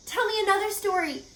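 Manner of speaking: high, quick pleading; begging. Acted. Wilbur begging Charlotte for another story, pleading. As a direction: beg like an eager child. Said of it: "begged Wilbur".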